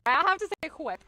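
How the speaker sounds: "Whip" loud, with a breathy HW at the start; American-style "Whip". Shown as a demonstration. Streamer copies how Americans say it, playful loud "Whip" as hwip. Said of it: "I just like it how Americans say it".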